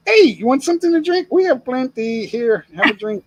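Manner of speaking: high, bright hospitable offer; fake-friendly host. Imitating card sharks offering drinks, high sweet hospitality. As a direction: coax like a too-kind host.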